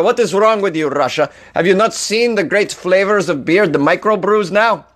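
bad, hammed-up Russian accent; ad-lib as a Russian in a clumsy accent; mock Russian accent, comically overdone. Shown as a demonstration. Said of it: "not gonna get better at this unless I try".

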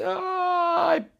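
very high, drawn-out mock scream; flying scream. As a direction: scream like someone hurled through the air. Cat screams while flying. (grito agudo de quien sale volando)

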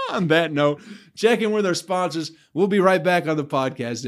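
laughing break call. Real, not acted. Host pivots to sponsor break.

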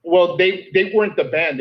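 ordinary delivery

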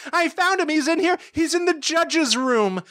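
very high falsetto, excited; high mock shriek; cartoon old ladies. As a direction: announce a discovery like screechy old ladies. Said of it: "And they're like".

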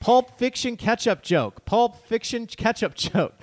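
mock yelling. Host reads out listener's emphatic correction, mock yelling. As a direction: pound out each word like an irate correction. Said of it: "He's, like, yelling at us".

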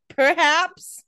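high, loud mock "perhaps"; put-on, exaggerated coyness; mock coy. Mock quote of Damon's evasive reply.

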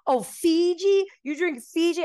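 high, loud mock amazement; mocking incredulity. Man mocking her bottled water, high incredulous imitation. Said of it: "making fun of me".

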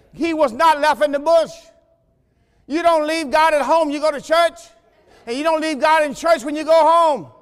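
full-force preaching. Real, not acted. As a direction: drive it home like a preacher.

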